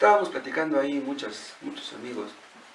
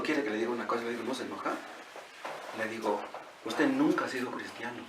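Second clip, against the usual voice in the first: hushed confidential; 7 semitones lower, 9 dB quieter than usual. Retold or imitated. Preacher retells confronting a man gently.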